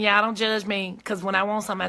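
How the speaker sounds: normal talking voice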